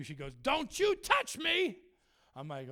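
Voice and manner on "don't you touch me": high, drawn-out mimicked warning; mock indignant refusal. Imitating girl refusing prayer, slow indignant warning. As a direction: stretch each word as a warding-off.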